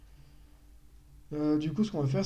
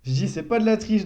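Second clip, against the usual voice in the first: c'est pas de la triche, louder and higher; emphatic repeat; 6 semitones higher, 7 dB louder than usual. Real, not acted. (répétition appuyée)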